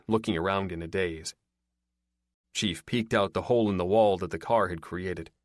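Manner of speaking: usual speaking voice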